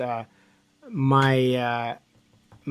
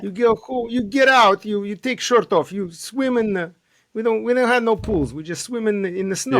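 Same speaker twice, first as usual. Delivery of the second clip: high, hammed-up Russian accent; joking Russian tough-guy accent; heavy russian accent menace; 8 semitones higher, 3 dB louder than usual. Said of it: "In Russia".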